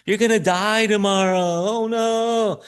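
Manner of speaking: high hammed-up wailing worry; mock panicked relatives.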